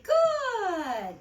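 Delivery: high, loud, drawn-out "Good."; praise voice. Shown as a demonstration. Trainer showing owners how to praise a dog, high and loud. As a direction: sing it out like a reward. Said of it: "you say".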